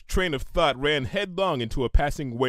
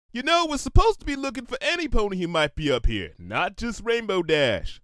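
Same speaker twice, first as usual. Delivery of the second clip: much higher, fast then slowing, dry correction; a high pony voice, pointedly correcting; Cloud Kicker's voice; 9 semitones higher, 4 dB louder than usual. A narrator's reading. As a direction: pegasus correcting her wingmate with a sigh.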